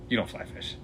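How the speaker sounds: breathy, whispered dismissal; curt put-down. Retold or imitated. Fisherman shutting down the newcomer, whispered put-down. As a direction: brush him off under your breath. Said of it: "like, shut me down".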